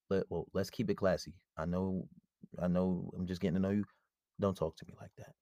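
low, soft, measured objection; calm boundary-setting. Acted. Man answering a new date's insults, calm and quiet.

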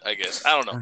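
usual speaking voice

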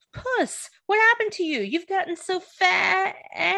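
teasing a fat cat in a high cartoon voice; Shrek impression. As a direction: squeak it out like a mocking Shrek. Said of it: "it's Shrek".

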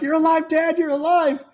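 high, loud delighted cries; mock excited kids. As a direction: imitate overjoyed children shouting to their dad. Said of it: "They're all excited".

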